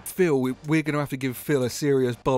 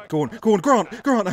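loud high urging of a player; will him to score; 7 semitones higher, 7 dB louder than usual. Real, not acted. Fan urging player Grant to score, excited shout.

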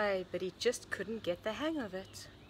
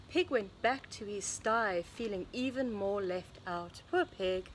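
usual speaking voice

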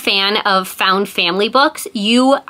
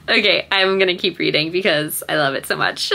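recovering from tears; 3 semitones lower, 3 dB quieter than usual. Real, not acted. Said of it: "I was tearing up".

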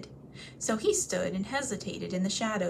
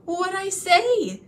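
much higher, slow, breathless; gasping; 11 semitones higher, 7 dB louder, much slower than usual. Acted. Bilbo, held by the hair, gasping his answer high and slow. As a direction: gasp it out in fear.

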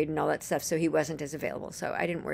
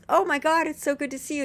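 high, excited exclamation easing off; imitating a thrilled reunion hello; retold delighted greeting; 8 semitones higher, 5 dB louder than usual. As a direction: greet a long-lost friend with a burst of joy.

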